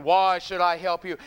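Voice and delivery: loud, imposing boom; booming Wizard voice. Acted. Great face in the sky to the visitors, booming. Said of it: "this great big booming voice is going".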